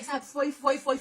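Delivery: ordinary talk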